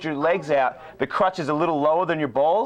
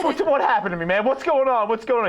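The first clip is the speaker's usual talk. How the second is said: distressed plea; 7 semitones higher than usual. Retold or imitated. Pleading to Kerry, near tears.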